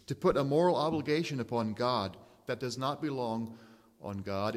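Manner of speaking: normal talking voice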